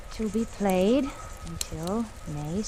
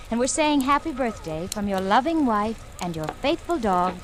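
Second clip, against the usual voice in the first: ordinary delivery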